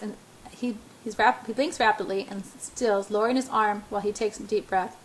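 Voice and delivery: ordinary delivery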